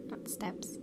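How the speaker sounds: whispered correction. Real, not acted. Correcting own misread line.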